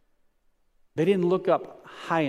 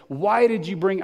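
whiny voice; 6 semitones higher, 4 dB louder than usual. Acted. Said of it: "I wanna read this kind of in a whiny voice".

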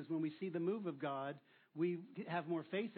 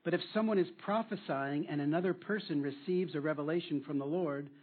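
louder, solemn Bible reading; scripture reading; 6 dB louder than usual. Acted. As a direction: proclaim the verses to the congregation. Reads scripture aloud loudly.

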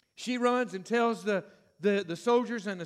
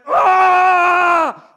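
high, loud furious roar; enraged roar; 8 semitones higher, 16 dB louder than usual. Acted. Samson roaring in fury at losing his wife. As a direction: let out a roar of rage.

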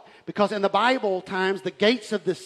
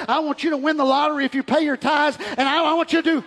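high, fast pulpit rally; fired-up preaching; 7 semitones higher, 4 dB louder than usual. Real, not acted. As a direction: fire rapid challenges at the congregation. Preaches at full force.

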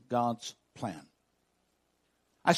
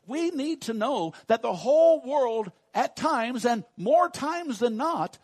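emphatic preaching; 9 semitones higher, 7 dB louder than usual. Real, not acted. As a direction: drive the truth home from the pulpit. Preaches at full force.

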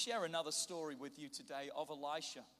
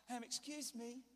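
high, timid, hesitant excuse-me; politely and meekly try to wake someone, pitched up; mock polite request; 5 semitones higher than usual. Servant as he did not speak to his master, mock timid. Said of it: "He's not like".

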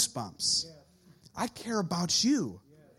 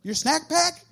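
higher teasing disbelief; mock incredulous; 8 semitones higher, 3 dB louder than usual. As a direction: needle the teen, hammed-up. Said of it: "It's like".

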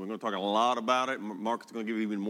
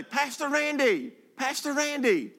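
put-on much higher little boy's voice, calling out; calling child; slower than usual. Pastor imitating a neighborhood boy calling his name, high child voice. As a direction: call after the pastor like an eager eight-year-old.

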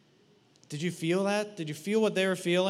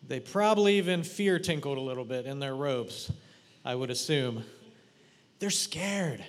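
ordinary talk, nothing marked

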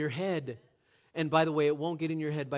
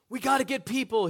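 higher and louder, retold shout; shouted back; 8 semitones higher, 8 dB louder than usual. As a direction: call it back over the chaos, raised and urgent. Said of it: "he shouted back".

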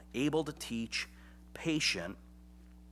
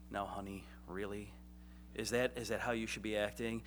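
softer, lower gentle chiding; gentle wife voice; 3 semitones lower, 6 dB quieter than usual. Retold or imitated. Wife gently chiding her husband, softer and lower. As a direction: coax him down gently like a patient wife. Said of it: "my wife will say".

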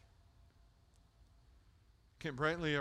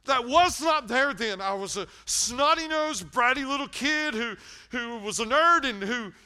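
high, loud, pounding confession; fired-up sermon peak; full-force preaching; 10 semitones higher, 12 dB louder than usual. Real, not acted.

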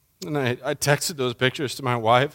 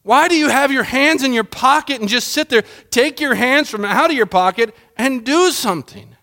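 raised, pushing demand for God to act; exasperated plea; 11 semitones higher, 4 dB louder than usual. Retold or imitated. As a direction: shake God awake with each question. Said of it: "it's like David is saying".

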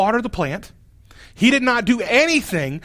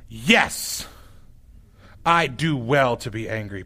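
sulky Jonah; 9 semitones lower, 4 dB quieter, slower than usual. Acted. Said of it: "And Jonah responds".

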